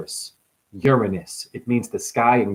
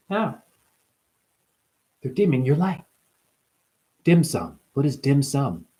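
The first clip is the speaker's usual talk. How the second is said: ordinary delivery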